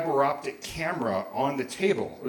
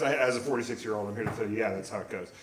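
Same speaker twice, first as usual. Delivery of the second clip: low, softer deadpan confirmation; resigned aside; 4 semitones lower, 5 dB quieter, faster than usual. Real, not acted. Older self answering his teenage rant, resigned. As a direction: drop down and confirm it plainly.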